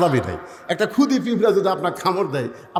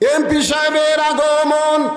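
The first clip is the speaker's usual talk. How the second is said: high, slow, sustained call; rallying welcome slogan; 10 semitones higher, slower than usual. Real, not acted. Preacher hailing the MP's arrival, high drawn-out slogan call. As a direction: proclaim the arrival for a crowd to echo.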